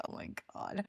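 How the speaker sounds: breathy whispered oh my God; a hushed, unimpressed aside. Retold or imitated. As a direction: mutter it in mock horror. Reaction to people showing tan lines.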